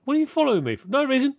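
squeaky mock challenge then innocent reply; high mock accusing player. Player challenging a follower in the game, then a mock innocent reply.

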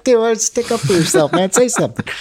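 urging a too-nice friend to stand up for himself, exasperated. Retold or imitated. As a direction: egg him on to fight back.

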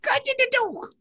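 high, lightly put-on British voice; Luther imitation. Quoting Luther to friends, light British accent. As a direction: quote the detective line in a Brit voice. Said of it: "I wish he talked like that".

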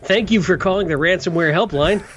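cheery automated hotline greeting; phone menu voice. Pretend. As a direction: sound like a call-centre recording. Mock phone hotline recording.

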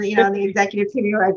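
normal talking voice

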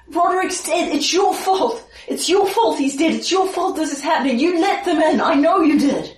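high-pitched, grief-stricken accusation; distraught accusing townsman. Acted. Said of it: "give you a bit more of his speech".

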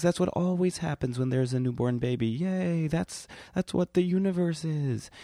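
put-on high, fast, excited baby voice; newborn baby voice. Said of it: "You're probably thinking".

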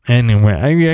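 normal talking voice

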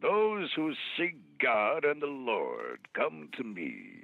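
slow, booming, commanding Heston; a slow, solemn Charlton Heston pronouncement; Charlton Heston impression. Shown as a demonstration. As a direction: preach it slowly like Heston. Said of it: "Charlton Heston".

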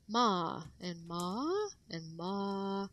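each "ma" on a different, overdone tone; exaggerated Chinese tones. Shown as a demonstration. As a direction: swing each "ma" through a big tonal contour. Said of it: "I kind of over exaggerated it".

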